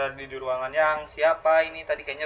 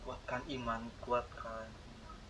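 very soft, slowing mutter to oneself; hushed self-encouragement; 3 semitones lower, 13 dB quieter, slower than usual. Real, not acted. Player steels their faith before a scare, hushed. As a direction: murmur it like a steadying mantra. Steeling himself in horror game.

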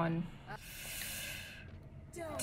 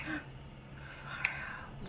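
breathless whisper of fright; real hushed fright; gasped whisper; 2 semitones higher, 18 dB quieter than usual. Real, not acted. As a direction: gasp it silently. Hiding close to enemy.

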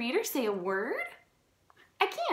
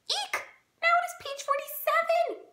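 much higher, shrieking alarm; panicked shriek. Acted. Gerald frightened the book is ending, shrieking high.

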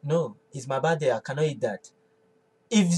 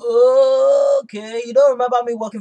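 higher, louder, rattled off fast; indignant outburst; 5 semitones higher, 6 dB louder than usual. Acted. Wife snaps at husband who forgets she earned the money, fast and indignant. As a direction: fire it back, offended and quick.

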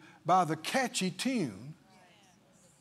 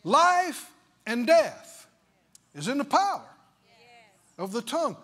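Life and death said slow and loud, higher; slow emphatic scripture; 5 semitones higher, 4 dB louder than usual. Shown as a demonstration. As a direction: proclaim it word by word. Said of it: "the Bible said".

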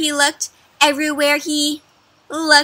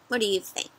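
ordinary delivery, nothing marked

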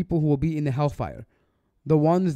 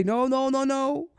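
a lightly raised, mock stern objection; imagined protest; 6 semitones higher than usual. Voicing the scolding the judge never gave, mildly stern. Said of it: "He didn't say".

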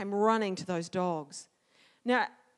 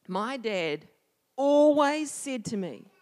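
ordinary delivery, nothing marked

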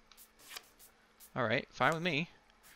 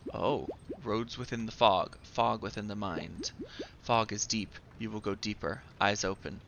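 ominous; 4 semitones lower, 4 dB quieter than usual. Acted. Player voices a riddle about the fog, ominous. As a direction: intone it like a cryptic warning, softly. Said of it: "Why is it so ominous?".

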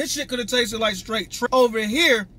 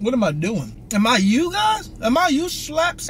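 ordinary delivery

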